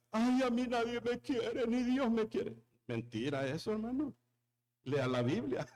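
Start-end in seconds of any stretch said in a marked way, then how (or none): 0.1-2.5 whiny self-pity, mock; mock complaining. Preacher mocking a complaining believer, whiny. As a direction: lightly imitate a whiner. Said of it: "habla tonterías y exagera".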